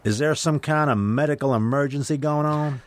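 Texas patrolman questioning the pulled-over driver; Southern patrolman. Retold or imitated. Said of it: "I'm not sure if I'm doing the accent right".

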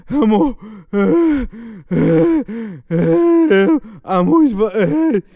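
high, slow mock crying, gulping for air; imitated sobbing gasps. Girlfriend can't get the news out, imitated gasping sobs. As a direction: choke on each word as if crying. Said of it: "man kriegt das Wort einfach nicht raus".

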